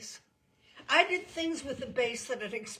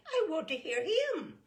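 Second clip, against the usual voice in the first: high, louder eager demand; eager woman; 9 semitones higher, 5 dB louder than usual. Acted. Fragrant woman insisting to the headmaster, high and eager. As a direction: insist on hearing the boy.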